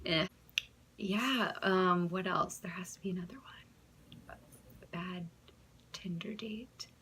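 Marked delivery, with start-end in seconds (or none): 2.6-6.9 soft unvoiced whisper to herself; whispered musing. Real, not acted. Searching her memory for a bad date, whispering. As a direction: whisper as if thinking aloud.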